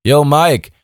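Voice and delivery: loud call over the wind. A narrator's reading. Emma calls to Mike over the wind, loud. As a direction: shout across the sky. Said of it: "rief sie durch den leicht zischenden Wind".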